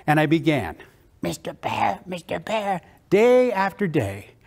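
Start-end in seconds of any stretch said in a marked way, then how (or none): 1.2-2.8 soft first attempt at a cartoon duck; tentative duck voice, not there yet; practising duck voice. Boy practises alone into his recorder, soft rough duck attempt. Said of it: "I'm gonna do that voice".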